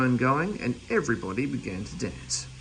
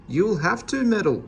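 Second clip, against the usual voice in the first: Mistress Fanny, brisk and firm, a bit higher; firm hostess; 6 semitones higher, 4 dB louder than usual. Acted. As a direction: send him off firmly. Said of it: "said Mistress Fanny at once".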